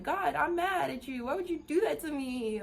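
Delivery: high, loud mock complaint; hammed-up angry plea to God; mad at God. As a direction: whine upward in acted outrage, ending in a groan. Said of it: "You're gonna be like".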